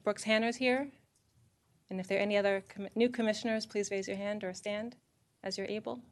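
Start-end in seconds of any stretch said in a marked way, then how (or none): none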